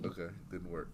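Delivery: usual speaking voice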